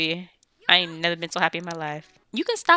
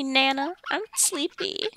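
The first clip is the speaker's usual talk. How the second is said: lightly voiced little kid, higher pitch; sleepy grandchild; 6 semitones higher, slower than usual. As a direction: put on a small child's voice asking Nana for bed.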